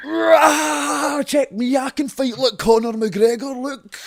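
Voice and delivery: high, loud mock bragging; rowdy fight-fan shouting. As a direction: roar like a drunk show-off in the crowd. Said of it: "they're all out like that".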